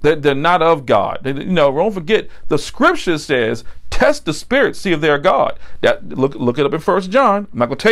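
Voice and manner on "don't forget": ordinary delivery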